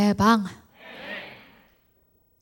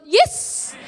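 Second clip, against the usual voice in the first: usual speaking voice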